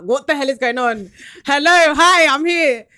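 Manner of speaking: loud and high, frantic shout; panicked calling out. Retold or imitated. Teller shouting at the interviewers on a dropped Skype call, frantic and loud. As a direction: yell into the frozen call.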